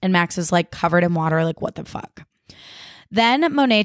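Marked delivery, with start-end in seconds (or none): 1.6-2.2 whispered in shock; stunned whisper. Retold or imitated. Soaked Max at the table, stunned whisper. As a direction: breathe it out, dumbfounded. Max covered in water voiced.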